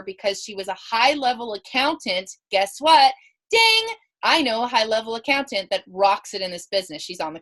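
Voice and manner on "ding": ding. Acted. Idea bell lightbulb moment.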